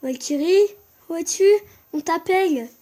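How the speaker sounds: loud high calling. Pretend. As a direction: shout for her across the field.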